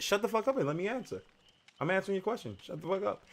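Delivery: a muffled mock telling-off, fast; hushed angry snap. Host snaps at imagined viewer.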